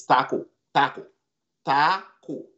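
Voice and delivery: "taco" with no S at the end; no final S. Shown as a demonstration. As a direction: say "taco" cleanly, no S. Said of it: "without the S".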